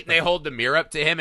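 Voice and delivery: ordinary delivery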